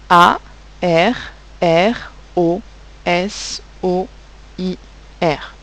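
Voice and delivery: each letter of arrosoir named slowly and separately; spelled out letter by letter. Shown as a demonstration. Teacher spelling arrosoir for pupils, letter by letter. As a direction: spell out A, R, R, O, S, O, I, R one at a time. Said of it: "spelling arrosoir letter by letter".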